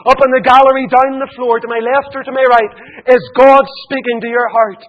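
loud, high, ringing revival preaching; preaching at full force. Acted. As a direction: pound the gospel out to the whole hall.

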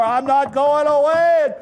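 loud, high defiant shout; emphatic refusal at full volume. Acted. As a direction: holler it stubbornly at the door. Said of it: "Raises his voice, knocks harder".

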